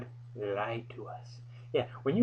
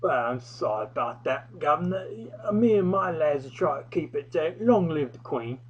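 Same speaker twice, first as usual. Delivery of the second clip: put-on slurred English accent; 4 semitones higher than usual. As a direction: slur a cheeky fake English reply. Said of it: "I more or less slurred".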